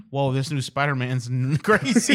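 laughter bursting through the quote; can't hold back the laugh; trying not to laugh. Retold or imitated.

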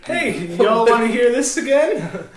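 high, loud, laughing DJ patter; hammed-up strip club DJ; mock DJ announcer. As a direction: hype it like a bored club DJ. DJ mocking himself on mic.

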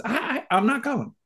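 high, soft refusal; small high-voiced balking; Ernie. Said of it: "and Ernie goes".

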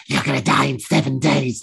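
lightly voiced demon threat; a hint of demonic possession; possessed demon voice. Shown as a demonstration. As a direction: play a possessed demon, mildly menacing. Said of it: "possessed by a demon".

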